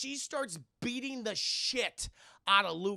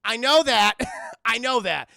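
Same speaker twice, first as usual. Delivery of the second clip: indignant shout; 2 semitones higher, 14 dB louder than usual. Acted. Liu Kang's indignant reply.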